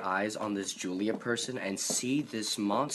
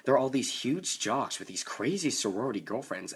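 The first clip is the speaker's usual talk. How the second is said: ordinary delivery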